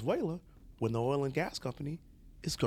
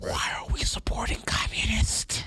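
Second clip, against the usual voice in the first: creepy frantic whisper; Joe Biden whisper; 7 semitones higher than usual. Pretend. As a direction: whisper it close, unsettling. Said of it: "talk like Joe Biden".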